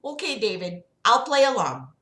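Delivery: high, singsong doctor voice; Sarah Palin. Acted. Doctor questioning her patient about God, high put-on voice. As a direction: pitch it well up, lilting questions. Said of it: "We'll just do Sarah Palin".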